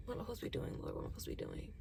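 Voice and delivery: breathy whispered plea to the Lord; whispered prayer. Retold or imitated.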